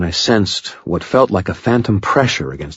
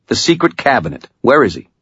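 higher-pitched, curt questioning; snapped demand; 7 semitones higher than usual. A narrator's reading. Murphy interrogates the carney, snapped. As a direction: press the captive for answers. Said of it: "she snapped".

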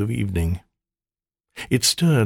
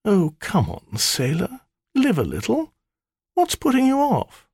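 much higher, teasing maiden voice; flirty temptress; 13 semitones higher than usual. Acted. Spirit flirting with her master, high and teasing. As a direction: bat the lashes and coax him.

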